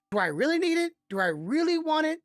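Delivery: acted-out inner debate; hesitant self-questioning. Voicing a hesitant buyer's inner questions.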